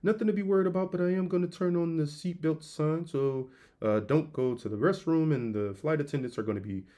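low, soft, steady captain voice; calm pilot announcement. Retold or imitated. Pilot reassuring passengers over intercom, low soft calm voice. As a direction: soothe the cabin like an unbothered captain. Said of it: "you sound cool".

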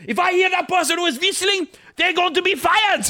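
put-on high-pitched German-accented threat; hammed-up German accent, mock fury; angry German accent. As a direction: play an outraged German star laying down the law. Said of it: "especially in a German accent".

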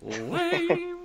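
very high squeaky jeer; drawn-out high-pitched teasing; mock jeer. Real, not acted. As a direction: squeal the verdict in a silly high voice. Mock verdict on the other's quitting.

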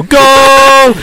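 high loud goal yell; showing off his goal call; commentator goal shout. Shown as a demonstration. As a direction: belt it out like a commentator. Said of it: "I can go".

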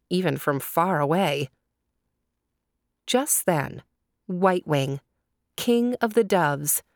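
ordinary delivery, nothing marked

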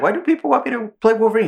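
quoted line pitched high and fast; high mock quote. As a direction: toss it off high and quick, mimicking. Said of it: "and he's like".

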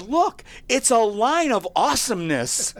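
high, laughing shout of delight; laughing kid. Retold or imitated. Tyler calls to his parents, laughing and shouting. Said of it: "Tyler goes".

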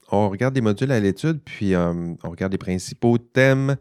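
ordinary talk

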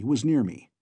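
ordinary delivery, nothing marked

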